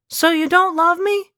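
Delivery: high woman's voice; high female voice. A narrator's reading. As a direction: voice her high and hurt. Elena asking.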